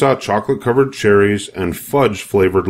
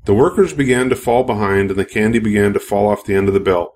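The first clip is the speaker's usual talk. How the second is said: ordinary delivery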